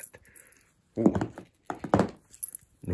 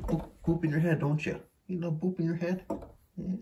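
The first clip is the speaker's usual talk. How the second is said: pet talk; 7 semitones higher than usual. Real, not acted. Cooing to pet about toy.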